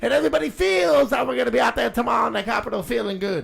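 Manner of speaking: shouted rally rant. Acted. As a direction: yell it to the crowd.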